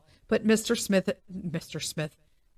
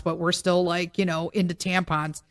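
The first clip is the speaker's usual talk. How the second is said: ordinary delivery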